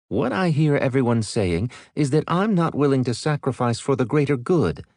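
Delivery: lightly voiced stern commodore; female commodore voice.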